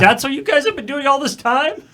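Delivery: high, loud, laughing disbelief; incredulous outburst. Acted. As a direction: act out astonishment, nearly cracking up. Newly standing crawler is amazed.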